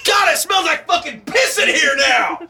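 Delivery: loud mock outburst; shouting. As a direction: yell it in disgust. Mock yelling about smell.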